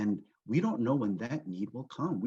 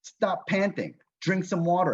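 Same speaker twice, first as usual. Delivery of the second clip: exasperated plea, higher and louder; frustrated; 5 semitones higher, 5 dB louder than usual. Retold or imitated. Owner begging his panting dog to drink, frustrated. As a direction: urge it in acted frustration. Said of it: "I'm so frustrated that I'm like".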